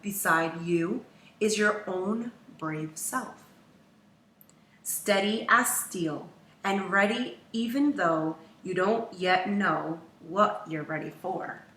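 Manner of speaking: ordinary delivery, nothing marked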